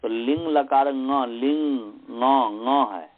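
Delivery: ङ as a velar nasal "nga", not "gya"; throat-and-nose ङ. Shown as a demonstration. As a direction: sound ङ through throat and nose. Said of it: "कंठ और नाक से बोलेंगे". (ङ कंठ और नाक से)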